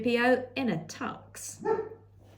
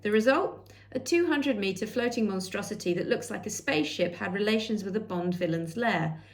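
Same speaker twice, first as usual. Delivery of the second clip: usual speaking voice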